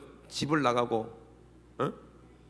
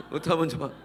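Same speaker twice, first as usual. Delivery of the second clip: usual speaking voice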